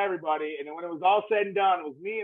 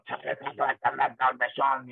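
very slow, strained voice of damaged cords; imitating a ruined voice, slowly; torn vocal cords voice; 4 semitones lower, much slower than usual. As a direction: drag the name out like her wrecked voice. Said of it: "Her voice was like that all the time".

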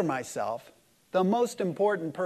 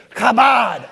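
high, very loud, drawn-out "kabod"; passionate group shout; 5 semitones higher, 11 dB louder than usual. Shown as a demonstration. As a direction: belt out "kabod" with throaty passion. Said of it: "try that a little bit harder".

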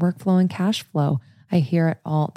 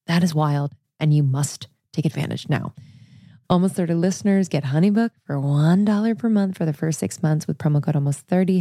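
ordinary delivery

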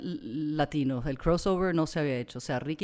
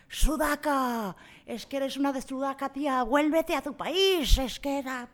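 mocking drunk insult; 10 semitones higher, 6 dB louder than usual. Retelling a drunk man insulting the owner, high sneering yell. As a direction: spit the slur like a drunk bully.

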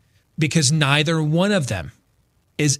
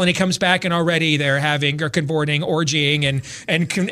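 ordinary talk, nothing marked

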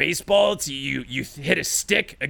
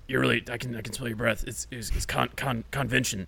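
intimidated mumble; 6 semitones lower, 8 dB quieter than usual. Acted. Intimidated, voice drops.

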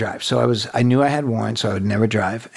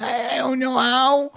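high, slow mock wail; fake crying. Asked to turn on the AC, fake childlike crying. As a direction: blubber it in a childlike put-on voice.

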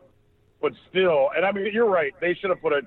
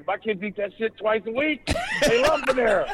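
laughing inner thought; 6 semitones higher than usual. Retold or imitated. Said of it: "And I'm thinking".

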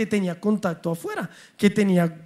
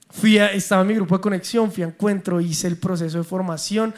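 usual speaking voice

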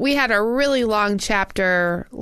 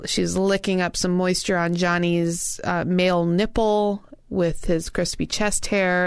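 normal talking voice

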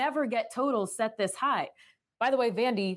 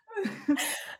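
high giggle; 11 semitones higher than usual. Real, not acted. Laughing fit continues.

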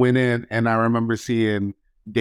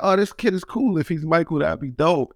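higher-pitched, pleased inner thought; retold enthusiasm; 8 semitones higher than usual. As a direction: imitate being keen on a new pal. Retells excited thought on train.

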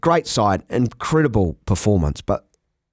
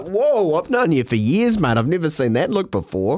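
mock amazed mate; 6 semitones higher than usual. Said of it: "it was like".

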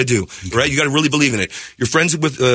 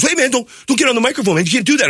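singer telling him off; 11 semitones higher, faster than usual. Retold or imitated. Singer to the guy grabbing the mic, mildly annoyed.

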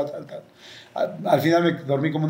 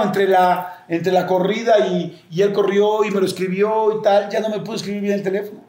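ordinary talk, nothing marked

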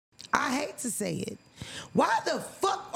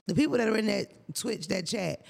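normal talking voice

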